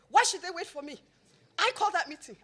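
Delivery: loud, high stress on I called; grumpy protest. Retold or imitated. Grumpy tycoon protesting on the phone, loud with stress on I. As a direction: insist it's your meeting, annoyed. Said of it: "the grumpiest he's ever been".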